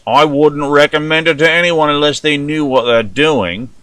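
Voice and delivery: put-on an amateur British accent on the whole line. American host doing a poor British accent for UK listeners. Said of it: "this poor attempt at your, uh, vernacular".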